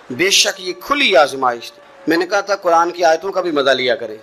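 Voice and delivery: usual speaking voice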